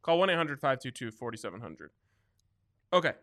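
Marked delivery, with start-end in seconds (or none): 0.0-1.9 rapid-fire legal fine print; fast legal disclaimer. Acted. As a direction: rattle off the terms quickly. Rushed legal terms read.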